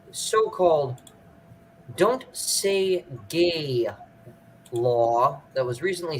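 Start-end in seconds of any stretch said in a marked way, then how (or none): none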